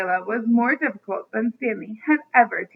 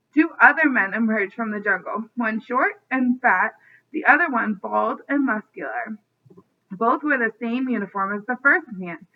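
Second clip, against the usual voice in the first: ordinary delivery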